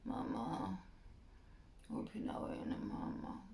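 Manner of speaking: whispery, barely audible sleepy mumble; drifting off to sleep. Acted. Actor running lines while nodding off, sleepy murmur. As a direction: murmur it softly as if falling asleep. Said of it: "As I'm falling back to sleep".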